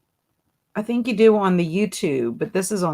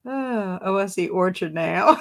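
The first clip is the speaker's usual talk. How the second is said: laughing shout. Real, not acted. Host finally seeing the hidden word, shrieking with laughter. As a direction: burst out laughing as you finally see it.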